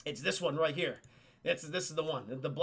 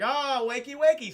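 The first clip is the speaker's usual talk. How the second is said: sing-song mock wake-up, rising louder; teasing wake-up call; 5 semitones higher, 6 dB louder than usual. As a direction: taunt them awake, playful villain. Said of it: "He's like".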